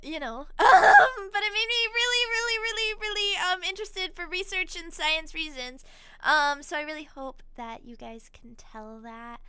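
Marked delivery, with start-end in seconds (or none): 0.6-5.8 excited squeal. Real, not acted. Overjoyed about merch launch.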